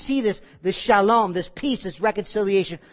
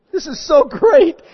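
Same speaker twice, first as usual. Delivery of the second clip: higher, louder, slow and overcome; tearful delight; 7 semitones higher, 8 dB louder than usual. Retold or imitated. Fan watching old championships, overcome and gushing. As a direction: gush like a tearful sports fan. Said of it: "I'm like".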